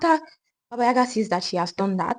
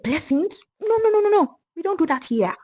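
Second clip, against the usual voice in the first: much higher, loud furious refusal; shouting witch; 10 semitones higher, 4 dB louder than usual. Acted. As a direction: explode in outrage. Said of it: "Baba Yaga shouted".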